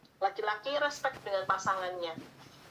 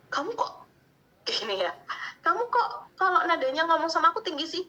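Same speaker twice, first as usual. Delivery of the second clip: higher, complaining reproach; high-pitched demanding; 7 semitones higher than usual. Retold or imitated. Wife demanding her husband speak gently, higher complaining tone. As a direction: nag him in a raised voice. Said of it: "tapi kita nadanya tinggi".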